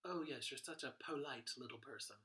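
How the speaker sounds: polite as POH-lite in a mock compliment; long O in polite. Shown as a demonstration. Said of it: "That's not how it works".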